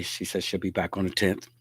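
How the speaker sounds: ordinary talk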